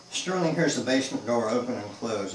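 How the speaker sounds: usual speaking voice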